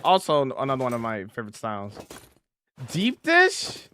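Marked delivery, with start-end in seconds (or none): 2.9-3.9 incredulous. Real, not acted. Reacting to chat suggesting deep dish.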